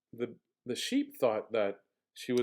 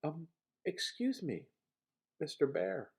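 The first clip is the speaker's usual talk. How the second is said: soft, hesitant Sheep; timid sheep; 7 dB quieter than usual. Acted. Sheep timidly addresses the sleeping bear, soft. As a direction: tiptoe the words to the bear. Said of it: "She tiptoed back inside".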